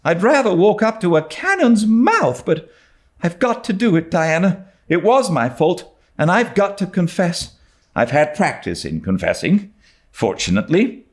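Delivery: put-on high earnest young girl steeling herself; nervous but determined; Anne, brave girl. A narrator's reading. Anne to Diana, earnest and high.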